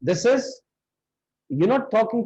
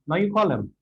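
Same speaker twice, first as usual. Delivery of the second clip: ordinary talk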